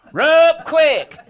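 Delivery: high, loud two-word holler; loud practice distress shout; trial yell. Retold or imitated. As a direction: punch it out as an urgent call. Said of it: "yell just as loud as you can".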